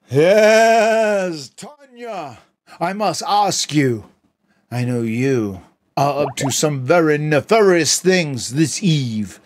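theatrical high pompous voice, then a shout; Broken Matt voice. Acted. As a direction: overblown villain, ending in a screamed word. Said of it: "summon the spirit once more of broken Matt".

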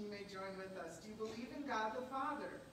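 usual speaking voice

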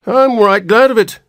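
very high, loud cracked whine; old man's whine. A narrator's reading. Old man glad to be leaving the hospital, whining. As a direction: whine it out like a peevish elder. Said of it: "whined the old man".

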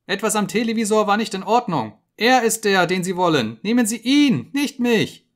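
high, loud frantic shriek; rising to a scream. Acted. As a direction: scream it out in terror. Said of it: "steigerte sich zu einem Schreien".

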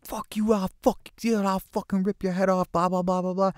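high-pitched mock yell; imitated yelling. Teller mimics a stranger threatening him, yelling. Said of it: "Now he's fucking yelling".

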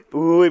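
sharp gasp, then a loud "uy"; startled gasp. Real, not acted. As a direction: caught off guard by the shot. Near double fault scare.

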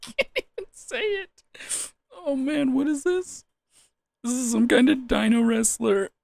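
high, laughing through tears; half crying with laughter. Real, not acted.

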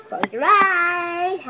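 very slow, stretched-out words; magician's drawn-out command. Pretend. Telling the audience before the card trick, drawn-out command. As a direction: draw it out like a stage magician.

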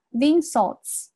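"the insults" run together as one word; linked the insults. Shown as a demonstration. Teacher demonstrating linking to students, "the insults" joined without a break. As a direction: glide from "the" straight into "insults". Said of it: "然后这个地方连读".

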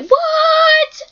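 very high, loud shocked squeal; shocked what. Retold or imitated. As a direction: squeal it in total disbelief. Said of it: "and I was like".

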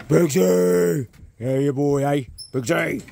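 calling out. Real, not acted. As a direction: call the dog from afar. Calling the dog across paddock.

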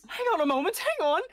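high, loud hang on; urgent exclaim. Acted. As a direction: stop them with a sudden cry. NPC urgently stops the party.

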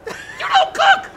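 high shout breaking into laughter; laughing shout. Real, not acted. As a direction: yell it while laughing. Shocked at robot cooking.

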